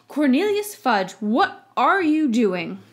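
loud, alarmed and slowing down; startled shout. A narrator's reading. Minister shouts in alarm at the intruders. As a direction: call out in shock at being caught. Fudge surprised at wand point.

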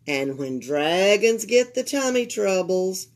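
usual speaking voice

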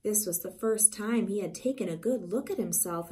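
ordinary delivery

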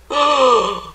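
loud audible gasp; shocked gasp. Acted. Reader reacting to the dog's new hump, shocked gasp. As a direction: gasp in amazement.

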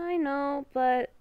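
ordinary delivery

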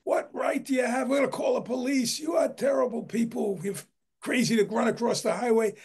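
high, shrill-free mock yell at trespassers; owners screaming. Homeowners yelling at trespassers from the gate, high mock yell. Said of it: "screamed at by the owners".

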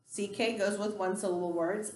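ordinary talk, nothing marked

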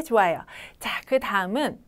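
usual speaking voice

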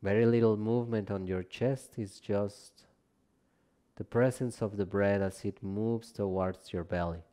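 calm, measured relaxation guidance, phrase by phrase; guided meditation. Acted. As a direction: guide them gently down into rest, unhurried and spacious. Leads a yoga nidra practice.

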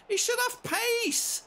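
high, loud and slow, stretched out; drawn-out furious shout. Real, not acted. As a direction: drag every word out in disgust. Repeated complaint, slower.

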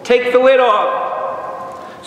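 high, drawn-out cry; exclaimed. Shown as a demonstration. Preacher proclaiming what manifest means, calling out. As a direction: call it out big and slow, pitch way up. Same definition repeated as a shout.

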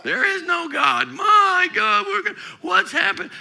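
high, loud mocking cry of despair; mock panicked shout. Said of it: "Christians act like God's dead".

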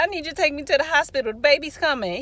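high, loud and very fast; insistent retold plea. Woman in labor repeats herself to brother, insistent. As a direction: urgently push through his confusion. Repeats request, more urgently.

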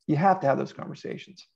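usual speaking voice

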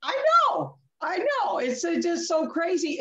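high, excited "I know, I know"; exclaiming. Real, not acted. Storyteller agreeing with her stunned friend, high and excited.

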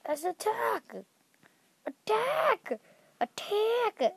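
high, rallying war cry; mock battle cry. Gamer rallying other players to attack, hammed-up battle cry. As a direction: charge everyone into the fight.

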